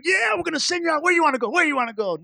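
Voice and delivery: high, eager imagined pastor; picture her cheering you on; a lightly voiced excited pastor; imagined excitement. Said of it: "I thought she'd be excited".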